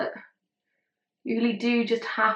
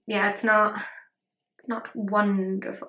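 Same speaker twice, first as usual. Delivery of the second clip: normal talking voice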